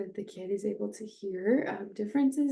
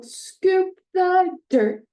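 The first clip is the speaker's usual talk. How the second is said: scoop stressed, higher, louder and slow; acoustic highlighting; 9 semitones higher, 7 dB louder, much slower than usual. Shown as a demonstration. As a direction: sing it out high for a child. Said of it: "with the visual".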